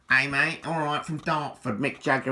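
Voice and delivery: loud, high cheeky Dartford geezer greeting; hammed-up Dartford accent, brash and matey; [name] Dartford accent. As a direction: call out like a Dartford lad, Estuary accent. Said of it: "Mick's got a sort of Dartford accent".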